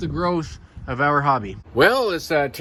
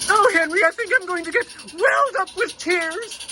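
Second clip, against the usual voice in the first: put-on much higher, sweet old lady voice, near tears; Marge; 13 semitones higher than usual. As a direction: play a tearful old woman in a high voice. Toy figure Marge speaks to Henry.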